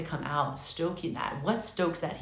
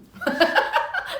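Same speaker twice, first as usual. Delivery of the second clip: a high, breathless giggle; 5 dB louder than usual. Real, not acted. Reader laughing at her own rant, high giggle. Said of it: "Sorry, I don't mean to laugh".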